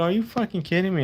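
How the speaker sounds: high, incredulous question; retold disbelief. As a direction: replay the shock of learning you did it wrong. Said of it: "I go".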